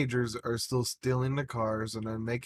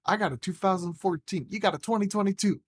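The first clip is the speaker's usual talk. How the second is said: high, gleeful explanation; retold excitement; 8 semitones higher, 4 dB louder, faster than usual. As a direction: spell out the good news, pitched up.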